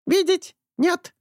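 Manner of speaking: high slow squawking bird. A narrator's reading. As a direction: parrot denial. Bird denies. (птичий крик)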